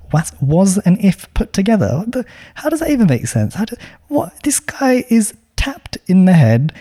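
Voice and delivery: mock outrage spiralling up into a hushed whisper; exaggerated disbelief. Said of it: "I've exaggerated a little bit".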